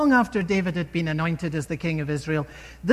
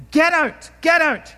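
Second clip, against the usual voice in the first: loud, higher shout from a distance; retold shout; 9 semitones higher, 7 dB louder than usual. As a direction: yell it down at the king from far off. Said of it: "This is what Shimei used to shout".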